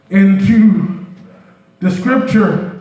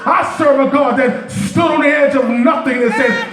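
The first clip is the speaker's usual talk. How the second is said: full-force preaching; 5 semitones higher than usual. Acted. Preacher lifting the church, full-force preaching. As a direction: preach it out to the congregation, rising and ringing.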